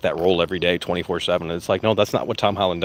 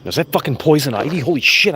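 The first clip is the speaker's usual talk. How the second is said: startled; 6 semitones higher, 5 dB louder than usual. Real, not acted. Spots poison ivy, startled.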